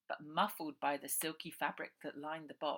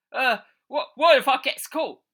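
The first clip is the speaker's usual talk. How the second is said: high, loud, stammering worry; high, loud, nervous lackey; scared henchman; 9 semitones higher, 19 dB louder than usual. Acted. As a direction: fret nervously to the boss. Henchman frightened.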